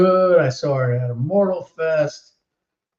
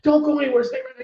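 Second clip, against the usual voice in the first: put-on very high squealy starstruck kid voice; squealing excited kid; 3 dB louder than usual. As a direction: squeal it like a kid who just met his hero. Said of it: "He's like".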